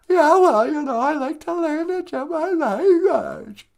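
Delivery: very high, loud little character voice; eager sidekick character. Acted. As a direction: squeal it like an eager puppet pupil. Sidekick character answers the host.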